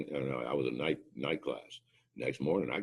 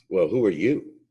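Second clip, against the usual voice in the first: rude challenge; 5 semitones higher, 11 dB louder than usual. Retold or imitated. Rude airman on the phone.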